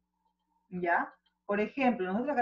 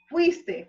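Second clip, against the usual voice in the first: Fuiste higher and louder, no final s; emphatic correct form; 7 semitones higher, 7 dB louder than usual. Shown as a demonstration. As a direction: stress Fuiste without the s. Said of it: "contrario a fuistes". (Fuiste enfatizado, sin ese final)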